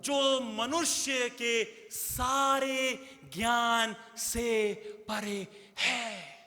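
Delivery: awed, hushed slow delivery. Real, not acted. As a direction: let each word sink in slowly. Preacher slowing for awe.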